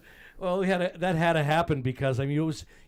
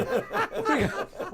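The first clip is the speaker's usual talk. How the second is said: slow, high, laughing through it; 12 semitones higher, much slower than usual. Real, not acted. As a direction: ask it while cracking up. Asking time while laughing.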